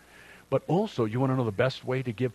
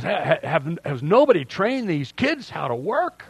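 mock exasperated son, pitched up; son's incredulous question; 5 semitones higher, 5 dB louder than usual. Pastor imitating his son on the phone, exasperated and higher. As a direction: ask it like he can't believe nobody trained them. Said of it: "And, and he goes".

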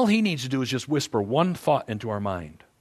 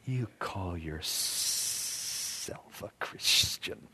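slow, low unvoiced snake hiss; hissed whisper; 8 semitones lower, 13 dB quieter, much slower than usual. Satan whispering to a believer, slow hissing whisper. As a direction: breathe it slowly into the ear. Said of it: "he'll start that whispering".

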